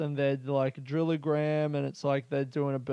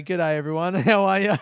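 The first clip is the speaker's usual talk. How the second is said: ordinary delivery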